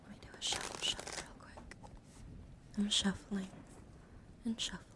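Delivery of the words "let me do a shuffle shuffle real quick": hushed, voiceless murmur; whispering. Real, not acted. Streamer shuffling tarot cards for viewers, whispered. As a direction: whisper it softly to yourself.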